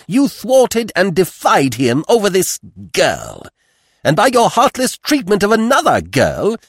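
high, loud, declamatory fury; wrathful mother, denouncing. A narrator's reading. Mother banishing her son from the house, wrathful. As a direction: hurl the accusations, commanding and unforgiving.

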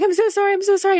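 high, loud, tearful imitation of a crying clerk; the clerk in the store apologizing through tears, high and loud; tearful apology. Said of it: "she just starts crying".

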